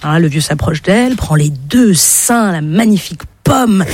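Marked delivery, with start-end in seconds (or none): none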